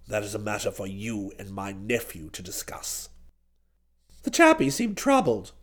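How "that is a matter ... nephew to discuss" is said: low, quiet aristocrat; declining old duke. A narrator's reading. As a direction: decline very softly, deep in the voice. Duke dismisses the businessmen.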